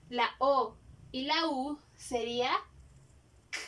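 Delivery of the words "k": "k" as a cat's hiss; hissing k sound. Shown as a demonstration. Teacher imitating a cat to show the C sound. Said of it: "este sonido".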